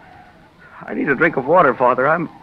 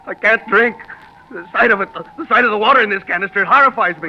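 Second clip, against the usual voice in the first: horrified; 7 semitones higher, 4 dB louder than usual. Acted. Feverish horror of water.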